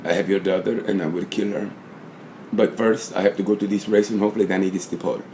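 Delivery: low, quiet threat like a film kidnapper; hitman voice. Retold or imitated. Vlogger imitating the manager as a Taken-style hitman, low and quiet. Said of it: "Then he sound like".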